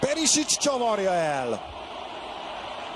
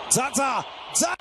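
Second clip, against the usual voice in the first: excited; 9 semitones higher than usual. Real, not acted. Zaza chance in play-by-play.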